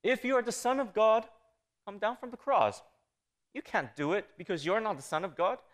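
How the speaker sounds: mocking dare, pitched well up; sarcastic taunt. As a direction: taunt them in Satan's sarcastic voice. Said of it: "verbal irony or sarcasm".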